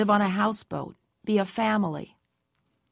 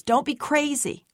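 yelling; 7 semitones higher than usual. A narrator's reading. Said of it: "Gran yells".

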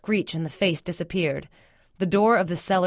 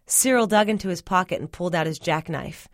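ordinary delivery

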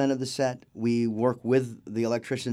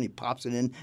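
ordinary talk, nothing marked

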